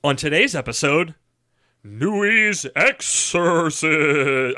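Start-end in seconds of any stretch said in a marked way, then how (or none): 1.8-4.6 very slow, drawn-out mock horror announcer; big spooky voice. Host announcing today's episode title, botched big spooky voice. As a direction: stretch the title into a hammy haunting. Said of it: "trying to do a big spooky voice".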